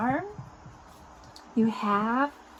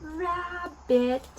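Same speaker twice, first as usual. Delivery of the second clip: "rabbit" stretched slowly into two syllables, pitched up; drawn-out demonstration; 6 semitones higher than usual. Shown as a demonstration. Teaching the word to small children.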